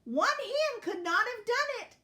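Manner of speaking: high, loud, triumphant declaration; emphatic declaring. A narrator's reading. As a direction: declare it proudly at high pitch. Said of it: "declared Dot".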